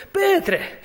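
high call. Acted. Preacher calling Peter by name, high call.

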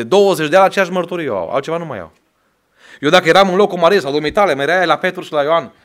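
loud, high, fast fervent sermon; preacher in full flight, pushing hard; preaching at full force. Acted. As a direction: hammer it home to the congregation.

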